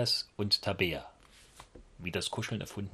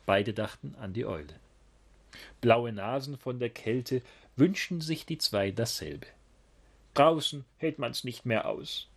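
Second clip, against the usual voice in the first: lively picture-book read-aloud; reading to children; 2 semitones higher than usual. A narrator's reading. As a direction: tell it like bedtime for little ones. (lebhaft vorgelesene Kindergeschichte)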